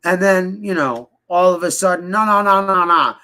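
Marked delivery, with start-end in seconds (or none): 2.1-3.1 louder, higher mocking protest; sarcastic imitation of denial; mock backpedaling. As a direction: parody someone hastily taking it back.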